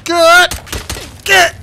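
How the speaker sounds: loud barked command; yelling. Real, not acted. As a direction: shout it at a fleeing enemy. Chasing enemy, yelling.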